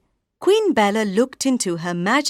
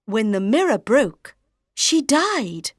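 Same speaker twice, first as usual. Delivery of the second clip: ordinary delivery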